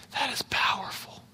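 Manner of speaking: whispered in awe; awed whisper. Real, not acted. Pastor marvelling to the congregation, whispered. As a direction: breathe it out softly, amazed.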